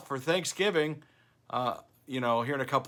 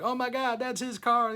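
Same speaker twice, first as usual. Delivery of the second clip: higher-pitched, starstruck mock shopper; excited gasp; 9 semitones higher than usual. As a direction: imitate a gawking fan spotting the car. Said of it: "they were like".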